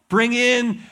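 "Bring in" called out loud and high; loud order. Retold or imitated. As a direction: bark the order to the servants. King orders the alcohol brought in.

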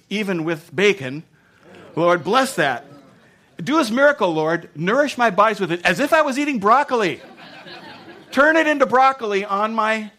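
building to loud, high mock pleading; hammed-up prayer plea. Diner begging God for a broccoli miracle, hammed-up pleading.

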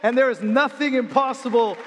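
a full-throated preacher's shout. Acted. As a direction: shout it to the back row.

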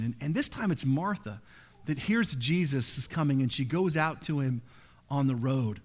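ordinary talk, nothing marked

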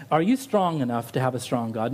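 ordinary talk

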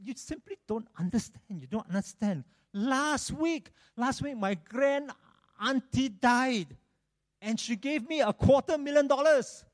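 mock lament. Sad man tells friend he doesn't understand, mock lamenting.

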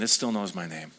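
low, quiet confidence; calm assurance. Acted. Jesus to the worried believer, calm assurance. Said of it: "And Jesus is saying".

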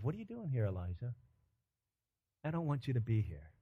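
quiet, sinking appeal; soft reproach. Acted. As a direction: drop down into a gentle rebuke. God's question turns soft and grave.

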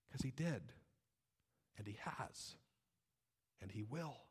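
soft, low and spaced out; hushed assurance. Real, not acted. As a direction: drop to a quiet, certain low tone. Preacher answers own question, hushed.

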